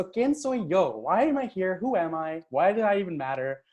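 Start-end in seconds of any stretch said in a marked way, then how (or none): none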